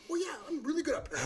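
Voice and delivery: high, soft put-on boast; mocking bad impressionist. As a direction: mimic an overconfident impressionist. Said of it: "Can you do her?".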